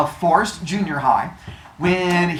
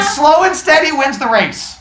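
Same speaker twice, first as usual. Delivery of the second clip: mock triumphant shout; 9 semitones higher, 4 dB louder than usual. Fable hero's victory line retold.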